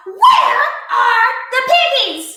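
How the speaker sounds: high, loud bossy witch voice; demanding witch. A narrator's reading. Witch demanding the duck hand over pigs. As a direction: boss the duck around, witchy.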